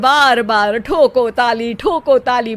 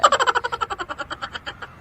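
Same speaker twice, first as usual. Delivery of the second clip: high giggle; 10 semitones higher, 9 dB quieter than usual. Acted. [name] laughs at his own couplet.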